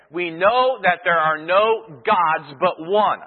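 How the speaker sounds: slow, deliberate voice of the Corinthians' claim; quoting the Corinthians. Retold or imitated. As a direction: lay out their argument slowly like their spokesman. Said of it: "Their simple argument is".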